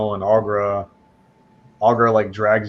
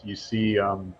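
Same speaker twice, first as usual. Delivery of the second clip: ordinary delivery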